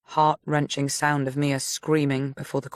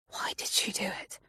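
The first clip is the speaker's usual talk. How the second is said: barely voiced whisper; hushed, shaken question; 5 semitones higher, 11 dB quieter than usual. A narrator's reading. Christy whispering to Connor in shock. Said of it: "barely above a whisper".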